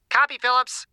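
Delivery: thin, high voice through an earpiece; small tinny voice over comms; tinny radio voice. A narrator's reading. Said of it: "the tinny voice said".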